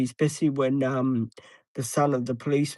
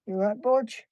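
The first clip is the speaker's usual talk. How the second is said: talking to a dog; 8 semitones higher than usual. Real, not acted. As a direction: ask the dog gently, pitched up. Checking on sleeping dog.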